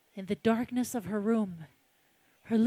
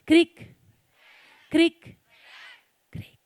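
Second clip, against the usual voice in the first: 1 creaky door; 6 semitones higher, 7 dB louder, slower than usual. Acted. Creaking door sound effect.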